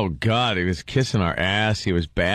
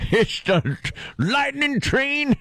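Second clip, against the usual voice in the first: ride announcer; 12 semitones higher than usual. Pretend. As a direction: stretch it out like a showman. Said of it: "working in a ghost town out west".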